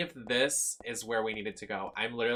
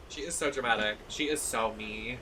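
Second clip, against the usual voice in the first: normal talking voice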